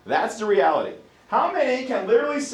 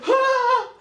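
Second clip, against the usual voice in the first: sudden high yelp of pain; mock cramp cry; 4 dB louder than usual. Athlete's body seizes in a cramp, mock yelp. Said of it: "It just literally seizes".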